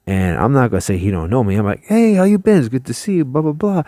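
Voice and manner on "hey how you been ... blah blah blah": hammed-up fake old friend; fake friendly greeting. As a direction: pretend you've known him for years, pitched way up. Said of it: "we're pretending like we know him".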